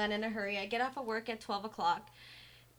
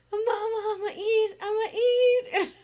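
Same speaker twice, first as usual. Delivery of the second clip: put-on much higher, louder, slow wailing child voice; imitating crying toddler; 12 semitones higher, 11 dB louder, slower than usual. Mom mimics daughter begging to eat, wailing sob voice. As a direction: wail it like a sobbing little girl. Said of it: "it was just like".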